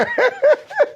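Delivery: short very high laugh; high giggle over the crowd; squeaky laugh. Real, not acted. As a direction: let a squeal of laughter escape. Laughing again mid-debate.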